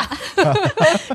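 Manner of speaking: slow, high, drawn-out echo of a dreaded rule; mock lab rule. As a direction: recite it like a haunting lab rule. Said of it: "这是噩梦般的一句话".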